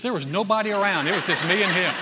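amused at the memory; laughing. Real, not acted. Preacher laughing as he recalls the confrontation.

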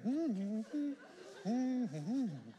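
high, loud closed-mouth humming in phrases; mute humming. Acted. Mute husband answering how his day was, high insistent hums. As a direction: try to talk with only hums.

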